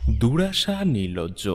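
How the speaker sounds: ordinary talk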